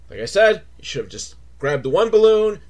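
ordinary delivery

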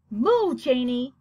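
ordinary delivery

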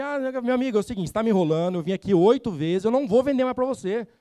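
alto e agudo, firme com o cliente; bronca encenada. Shown as a demonstration. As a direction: enfrente o cliente sem medo. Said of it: "E se o cliente". (vendedor enfrentando o cliente, alto e firme)

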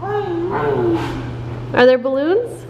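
sing-song picture book questions; reading to a toddler. Acted. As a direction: coax the baby to point things out.

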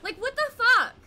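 loud, high frustrated rant; exasperated yelling. Real, not acted. Streamer venting loudly at her game, exasperated.

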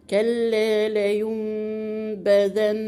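measured Quranic chant of "كلا لينبذن", holding "mm" before the ba; iqlab with ghunnah. Shown as a demonstration. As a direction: recite "كلا لينبذن" slowly, the first noon a long hummed meem. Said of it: "مع مراعاة الغنة".

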